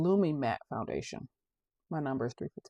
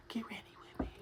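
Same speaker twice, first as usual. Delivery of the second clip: hushed, softer repeat; whispering; 5 semitones higher, 13 dB quieter, faster than usual. Shown as a demonstration. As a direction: whisper it to the camera.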